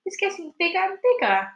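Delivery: high excited cry. Acted. Aunt watching the peach swell, high excited cry. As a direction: cry it out in wonder. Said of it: "Aunt Spiker cried".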